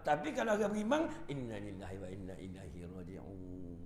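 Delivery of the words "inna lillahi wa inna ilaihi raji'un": low, soft calm recital; calm believer. Acted. Preacher voicing a calm believer, low and soft. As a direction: murmur it with calm acceptance.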